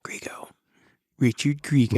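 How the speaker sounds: breathy hushed whisper. Real, not acted. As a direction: whisper the name reverently. Echoing the name in a hush.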